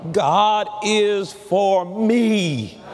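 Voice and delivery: very slow, me stretched at the end; stress on me. Shown as a demonstration. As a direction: drag it out and land on me. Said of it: "let's put emphasis on each of those words".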